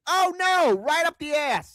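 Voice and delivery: high mock dismay; sarcastic mock alarm. Mocking the censored.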